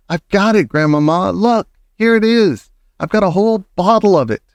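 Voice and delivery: high, gleeful boasting; boy showing grandmother his prize; excited. A narrator's reading. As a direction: bounce with triumph. Boy jumping with triumph.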